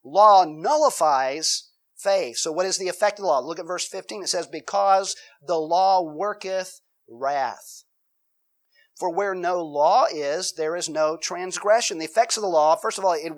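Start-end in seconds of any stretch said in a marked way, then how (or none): 0.0-2.4 slow, loud, weighty declaration; emphatic point. Real, not acted. 4.5-12.0 slow, deliberate Bible reading; scripture reading. Acted.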